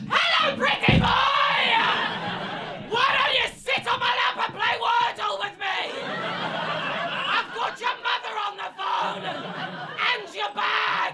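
shrieking falsetto, very high and fast. Acted. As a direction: scare him off with a wild squealing falsetto. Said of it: "if I talk to them in this voice".

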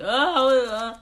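high, loud whiny wail for food; mock whining. Retelling cravings while fasting, hammed-up whining for chicken wings. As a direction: whine like a starving kid begging for snacks. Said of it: "whining and about stuff".

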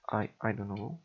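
normal talking voice

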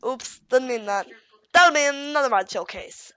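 ordinary talk

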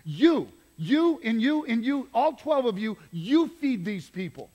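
each you hit hard and loud, then slow on you feed these people; emphatic pointing. Shown as a demonstration. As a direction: jab every you at a different disciple. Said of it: "this is emphatic".

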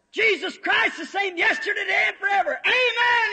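high, loud holler; hollered back. Acted. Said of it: "he hollered back".

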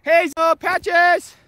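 high, loud call-out; calling dogs. Real, not acted. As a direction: shout the pets' names across to them.

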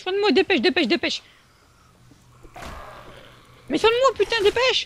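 frantic plea for healing; raging gamer. Real, not acted. Begging teammate to heal him.

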